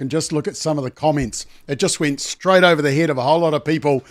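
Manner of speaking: ordinary talk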